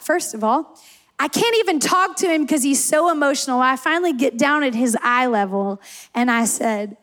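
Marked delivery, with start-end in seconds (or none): none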